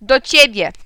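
loud call to hand over the phone. A narrator's reading. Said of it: "zawołał do mnie". (głośne zawołanie)

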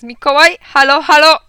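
loud, high calling into the phone; shout. A narrator's reading. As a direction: yell to find out who is listening. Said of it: "krzyknął Alcest". (głośne, wysokie nawoływanie)

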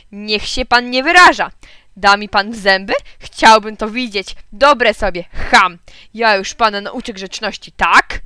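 loud, indignant phone row; angry quarrel. A narrator's reading. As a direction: square up to the caller, offended. (głośna, oburzona kłótnia)

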